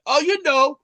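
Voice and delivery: high, bright mock praise; hammed-up congrats; mock cheerful. Acted comedian quote.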